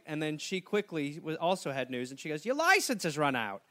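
usual speaking voice

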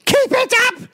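very high shouted order; mock shrieking mother. Mother banishing young Charles to Hungary, mock shriek.

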